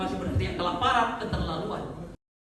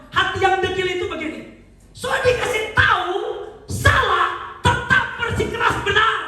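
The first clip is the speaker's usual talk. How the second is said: high, loud, driving sermon voice; full-force preaching; 11 semitones higher, 7 dB louder than usual. Acted.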